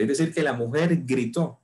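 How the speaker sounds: usual speaking voice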